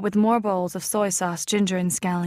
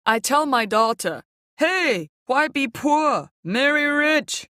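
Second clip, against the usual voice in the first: high, loud, slow punchline delivery; milk the joke he rehearsed; uncle telling joke; 6 semitones higher, 3 dB louder, slower than usual. A narrator's reading. Uncle Tin telling his marry-rich joke, high and slow.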